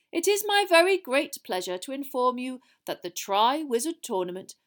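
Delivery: grand, projected headmaster's announcement; Dumbledore addressing the hall. Acted. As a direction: announce a great event to a full hall.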